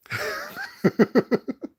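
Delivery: shrieking high-pitched laugh; high squeal laugh. Real, not acted. Burst of laughter.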